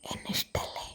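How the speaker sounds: whispered softly, almost silent; wife whispers a loving question into her husband's ear. Acted. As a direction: murmur it into his ear. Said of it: "പതുക്കെ മന്ത്രിച്ചു".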